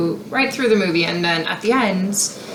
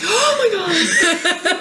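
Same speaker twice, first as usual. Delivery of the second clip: excited fan; 11 semitones higher, much slower than usual. Retold or imitated. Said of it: "And the girl's like".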